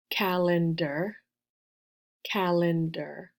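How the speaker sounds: "calendar" slowly split as cal-en-der; counting syllables. Shown as a demonstration. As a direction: give each beat of "calendar" its own time, slowly. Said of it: "how many syllables in".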